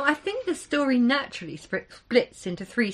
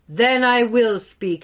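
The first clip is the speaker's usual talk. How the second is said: slow, louder, weighty pronouncement; giant's voice; 8 dB louder, slower than usual. Acted. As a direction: speak like an ancient giant. Giant accepts, slow and loud.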